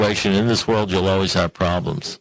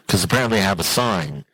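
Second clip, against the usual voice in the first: ordinary delivery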